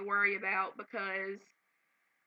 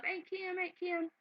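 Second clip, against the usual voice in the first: high, soft imitation of excited kids calling; 9 semitones higher, 7 dB quieter than usual. Storyteller imitating her kids calling their aunt's name, lightly, high and soft. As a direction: mimic the kids running up to greet her.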